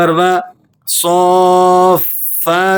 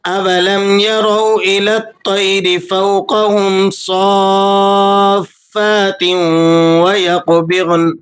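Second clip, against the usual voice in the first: measured, melodic tajweed recitation; Quran recitation; 5 semitones higher, slower than usual. Acted. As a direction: recite the verse slowly and melodically. (تجوید کے ساتھ تلاوت)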